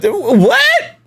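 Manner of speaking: high, drawn-out rising "what"; baffled disbelief. Real, not acted. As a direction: blurt it in real disbelief. Baffled by Skinny's claim.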